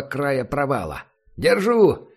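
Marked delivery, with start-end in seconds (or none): 1.4-2.1 loud high shout of triumph. Acted. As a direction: yell it out while grabbing. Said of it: "крикнул он".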